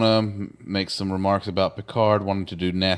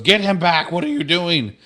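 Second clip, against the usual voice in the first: mock frantic shout; 7 semitones higher than usual. Mother runs onto bridge shouting.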